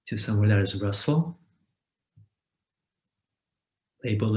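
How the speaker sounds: calm meditation guide with long, unhurried pauses; guided meditation. Acted. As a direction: guide listeners gently into rest, leaving space after each phrase.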